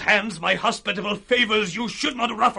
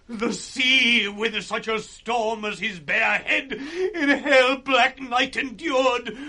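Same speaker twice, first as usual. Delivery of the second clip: usual speaking voice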